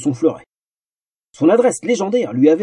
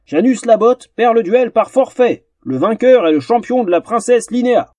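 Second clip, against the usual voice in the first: formal announcement; 7 semitones higher, 3 dB louder than usual. Acted. Captain announces the forfeit to the crowd, ceremonially. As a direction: proclaim the verdict to the arena.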